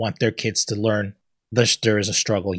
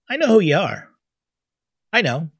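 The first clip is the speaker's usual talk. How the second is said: higher, louder knowing voice; mock Tyler Perry; 7 semitones higher, 4 dB louder than usual. Tyler Perry answering the actress, in a higher knowing voice. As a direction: play Tyler Perry, amused he already knows her. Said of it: "Just means".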